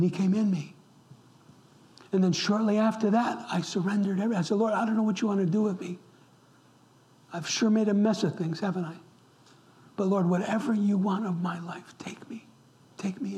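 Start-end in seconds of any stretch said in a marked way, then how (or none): none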